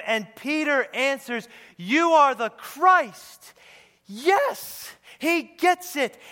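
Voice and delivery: loud, high exultant proclamation; full-force preaching. Real, not acted. Preacher proclaiming Peter's answer to the congregation, exultant full-force preaching. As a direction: build to a triumphant shout, then drop on the last words.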